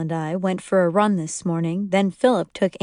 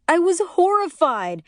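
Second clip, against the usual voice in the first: high, emphatic exclamation; exaggerated horror; 9 semitones higher than usual. A narrator's reading. Narrator telling Danny she was horrified. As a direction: throw it out in mock horror.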